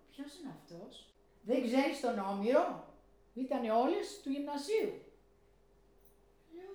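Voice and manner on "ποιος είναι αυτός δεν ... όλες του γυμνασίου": louder, slower mocking disbelief; scornful retort. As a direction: scoff at the girl who knows nothing. Coworker scoffs at her ignorance. (περιφρονητική απορία, πιο δυνατά)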